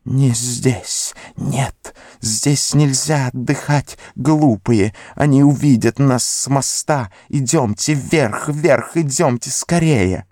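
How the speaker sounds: frightened wheedling hiss; Gollum hissing whisper. Acted. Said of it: "снова заговорил свистящим шёпотом".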